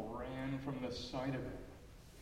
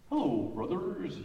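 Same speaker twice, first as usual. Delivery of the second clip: higher, louder, eager hello; disguised duckling greeting the flock, eager and loud; 4 semitones higher, 9 dB louder than usual. Acted. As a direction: greet them hopefully and loud. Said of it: "he said, bowing low".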